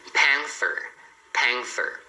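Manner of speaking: panther with tongue between the teeth on th; TH sound. Shown as a demonstration. Teacher shows T plus H, th said in panther. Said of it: "som da língua entre os dentes".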